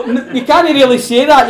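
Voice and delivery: ordinary talk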